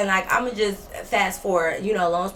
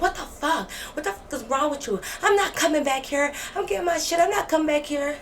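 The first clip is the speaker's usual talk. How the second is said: much higher, outraged roommate voice; shrill roommate; 9 semitones higher than usual. Retold or imitated. Roommate shrieking after being beaten, much higher pitched. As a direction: play the shocked roommate, pitched way up.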